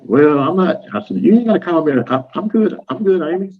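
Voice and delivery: a touch of playful Amy imitation. Mimicking Amy's reaction.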